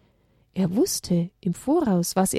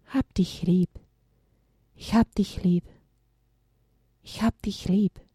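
tender; 3 dB quieter than usual. Acted. Jesus' loving gaze like mama.

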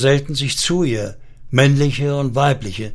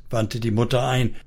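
plain reading voice

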